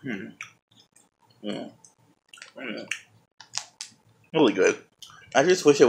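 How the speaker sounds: mumbling with mouth full; closed-mouth humming while eating; chewing. Real, not acted. Seen 4: Bites cookie at -4; closed jaw working while holding cookie throughout.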